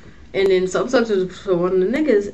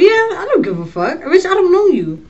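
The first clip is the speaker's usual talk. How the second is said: higher, louder mock brush-off; defiant inner retort; 9 semitones higher, 5 dB louder than usual. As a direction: toss it off like you couldn't care less. Voicing her own inner retort.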